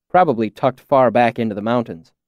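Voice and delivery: ordinary delivery, nothing marked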